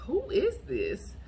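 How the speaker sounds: high, slow drawn-out who is this; baffled. Retold or imitated. Reading a text from an unknown number, high and slow. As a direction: stretch it out in disbelief.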